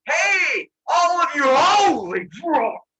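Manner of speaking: high, loud holler stretching into shock; shouted call, appalled. Retold or imitated. Zeus calls into the pit and sees the monsters, appalled shout. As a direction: holler down, then drag out the horror.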